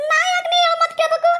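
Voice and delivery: high, pleading village woman voice, close to tears; frightened woman. Acted. A scared woman begging the ghost to leave her, high tearful plea.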